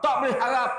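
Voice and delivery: angry husband. Retold or imitated. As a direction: scold in hammed-up disgust. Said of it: "Dia marah".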